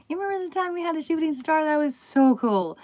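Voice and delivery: high and gleeful, teasing the universe; a bright giddy reminder to the sky; playful excitement. Retold or imitated. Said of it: "And I was like".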